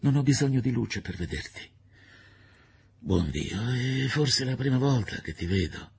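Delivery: a touch of drama in her voice; lightly voiced Fosca, emotional. Sick man's lover speaking at his bedside, emotional.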